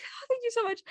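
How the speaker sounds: high-pitched, grateful squeak; gushing thanks. Retold or imitated. Guest thanks the mom for the invitation, high and gushing.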